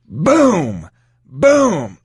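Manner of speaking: high, punched-out booms; exclaimed impact. Retold or imitated. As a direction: hit each one like a landing kick. Acts out the kicks landing.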